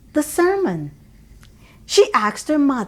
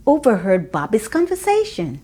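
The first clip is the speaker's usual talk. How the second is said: ordinary delivery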